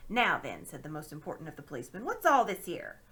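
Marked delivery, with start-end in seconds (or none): 0.1-0.6 stern constable. Acted. Policeman takes charge. 2.0-3.0 loud, high official demand; stern constable. Acted. Policeman demands what is going on, stern and loud.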